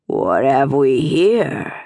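low, slow menacing drawl; leering stablemaster. A narrator's reading. Stablemaster to the cornered runaway, low and menacing. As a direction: savour it like a predator cornering prey.

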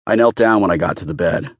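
plain reading voice

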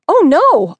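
sudden high, loud cry of alarm. A narrator's reading. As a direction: gasp it out in fright. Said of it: "I cried".

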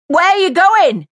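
high, loud, slow call down from a balcony; yelled. A narrator's reading. Mary yelling down to Jace from the balcony. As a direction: yell it after him from above. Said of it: "she yelled over the rail".

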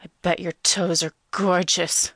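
slow, relishing creepy voice; slow pervy phone-caller voice; creepy groaning caller. A narrator's reading. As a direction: groan it out slowly, savoring it. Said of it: "Foot Fetish Guy groans".

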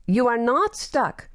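slow, firm and matter-of-fact; no-nonsense tone. Acted. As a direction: settle it without any fuss. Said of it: "Olsen's no-nonsense tone".

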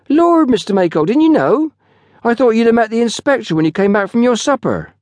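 put-on very high, loud young porter; astonished young porter. A narrator's reading. Porter finds his boss knows nothing, astonished. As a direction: blurt it in a lad's high-pitched country voice.

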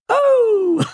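very high shrieking laugh; manic laughing shout. Acted. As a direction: burst out in manic glee. Peter bursting out singing.

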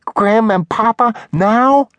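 put-on very high, slow, excited child voice; a small girl thrilled with expectation; drawn-out, high-pitched childlike delight; joyous little girl. A narrator's reading. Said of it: "Her eyes widen with joyous expectation".

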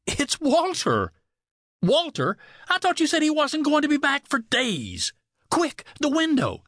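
high, urgent, alarmed exclamations; panicked alarm. A narrator's reading. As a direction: blurt it out in panic as you're caught. Lovers caught as husband returns.

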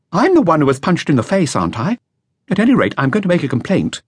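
put-on a nervous young witness reporting to police; a hint of an anxious young musician; young flautist. Flautist insists.

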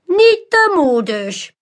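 high, slow, theatrical magician voice; mysterious magician. A narrator's reading. Magician Manolo invites the crowd, slow and theatrical. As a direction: lure the crowd in like a showman.

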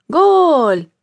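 high, loud goal cry; sudden cry of goal from the spectators; shout. A narrator's reading. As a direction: yell it from the crowd. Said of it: "Un grito interrumpe".

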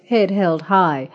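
usual speaking voice

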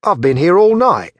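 frightened plea. A narrator's reading. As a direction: beg for your life with the blade at your throat. Thief begging under blade.

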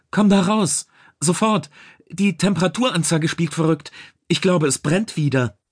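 high-pitched, alarmed warning; urgent warning. A narrator's reading. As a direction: get him out of there now. Crewman warns boss of fire.

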